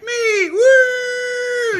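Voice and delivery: high, loud whooping scream; excited yell. Retold or imitated. Fan pumping his fist at the page's question, screaming woo. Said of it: "You know, and yell, scream".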